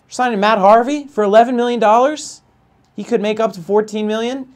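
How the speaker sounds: high, loud outraged disbelief; Jerry Seinfeld outraged voice. Real, not acted. Gamer ranting to chat about the Angels, high outraged voice. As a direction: pitch way up in mock outrage at the Angels. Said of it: "I got, like, a Jerry Seinfeld outraged voice right now".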